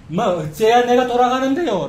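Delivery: defiant challenge. Acted. Preacher voicing the people answering God back, defiant challenge. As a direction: talk back as if demanding an answer. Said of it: "라고 따지는 거잖아요".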